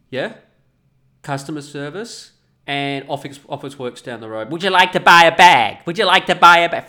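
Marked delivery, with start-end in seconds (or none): 4.5-6.9 loud high mock clerk voice; mocking shop clerk. Mocking the Officeworks clerk, loud and high. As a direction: blare the clerk's line back, mocking.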